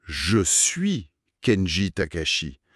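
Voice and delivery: slow je suis Kenji Takashi, weight on suis; teacher lands on suis in je suis Kenji Takashi; slow correct model. Shown as a demonstration. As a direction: model the right form slowly. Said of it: "La structure correcte".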